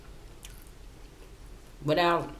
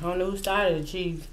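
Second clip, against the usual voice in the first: talking while chewing; eating between words; mouth partly full; 4 semitones higher than usual. Real, not acted. Seen 0: Puts food bits in mouth from fingers, chews while talking early on.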